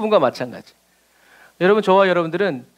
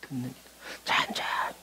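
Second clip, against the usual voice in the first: much softer, fading to a whisper; hushed listening prayer; 8 semitones lower, 14 dB quieter than usual. Shown as a demonstration. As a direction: drop to a whisper on the last word. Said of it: "기도는 하는 게 아니라".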